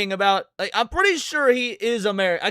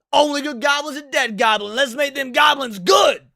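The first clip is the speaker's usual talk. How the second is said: battle cry; 6 semitones higher, 5 dB louder than usual. Pretend. Hyped fantasy battle cry.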